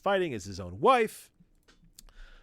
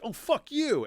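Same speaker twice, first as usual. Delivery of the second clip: high, sharp indignant jab; mock angry retort; 12 semitones higher, 4 dB louder than usual. Wolverine snapping at the woman who tricked him, mock anger. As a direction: fire back like Wolverine.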